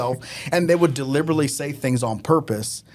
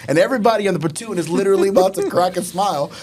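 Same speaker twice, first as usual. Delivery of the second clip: normal talking voice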